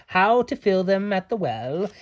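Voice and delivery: ordinary delivery, nothing marked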